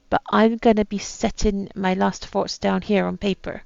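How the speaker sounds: attempted pirate accent, reading aloud. Captain writes his last words, attempted pirate accent. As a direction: read it like an old pirate captain. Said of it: "my attempt at a pirate accent".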